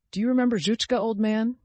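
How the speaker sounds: put-on sudden high, loud question to the sick boy; fired question. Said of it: "He suddenly fired the question".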